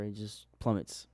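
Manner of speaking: low, slow and dragged out; ominous plummet. Acted. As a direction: let it sink like the price. Dramatic drop in trading story.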